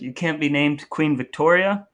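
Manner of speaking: normal talking voice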